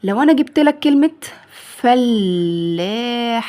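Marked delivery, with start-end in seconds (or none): 1.8-3.5 very slow, held vowels; drawn-out dictation. Shown as a demonstration. Teacher giving a word to make singular, drawn out. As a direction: stretch each syllable right out.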